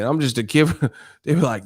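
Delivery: ordinary delivery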